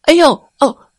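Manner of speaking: sudden high, loud cry of fright; startled yelp. Acted. Ni crying out as he slips off the cliff, startled. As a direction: jolt as if pushed.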